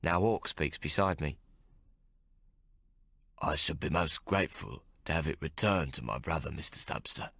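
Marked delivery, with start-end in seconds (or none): 3.4-7.3 put-on low, soft gravelly older man. A narrator's reading. Hawk politely asking the mate, low gravelly. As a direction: keep it low and rough. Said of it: "he says in his gravelly voice".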